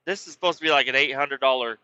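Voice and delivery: ordinary talk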